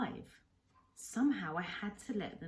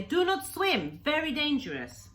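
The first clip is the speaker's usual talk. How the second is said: much higher, loud urgent cry of warning; shouted warning; 9 semitones higher, 9 dB louder than usual. Acted. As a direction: yell it out to stop him swimming. Kensuke warning the boy.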